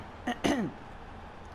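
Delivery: rough throat clear; real throat-clearing before talking on. Real, not acted. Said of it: "なんか声枯れてきちゃったな".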